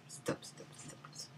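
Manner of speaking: quiet, voiceless repeated command; whispered hushing. Real, not acted. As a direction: hush them under your breath. Hushing her dogs. (ordre chuchoté)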